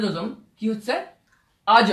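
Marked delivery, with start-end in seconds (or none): 1.7-1.9 আজ with the jim bouncing at the end; qalqala bounce. Shown as a demonstration. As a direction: jump the final sound of আজ. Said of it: "কিরকম উচ্চারণ হচ্ছে". (কলকলা দিয়ে আজ)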